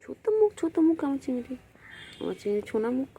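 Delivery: high, doting coo. Real, not acted. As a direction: gush over the cat's tiny face. Doting on cat's little face.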